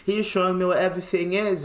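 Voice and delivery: high, quick mock-British voice; British accent. Friend explaining himself to cops, quick British accent. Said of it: "he's just talking like that".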